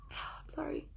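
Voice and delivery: whispered apology through tears; a shaky breath, then barely voiced sorry; tearful sigh. Real, not acted. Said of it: "I'm trying so hard not to cry".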